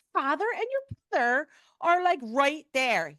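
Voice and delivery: high-pitched acted astonishment, slowing at the end; astonished. Telling husband his dead relatives are there, astonished. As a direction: imitate yourself amazed, voice pitched high.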